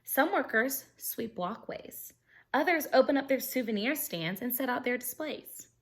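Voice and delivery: bright, lively storybook reading; animated read-aloud for kids; reading aloud to children. Acted. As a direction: read it like story time for a class. Reading picture book to class.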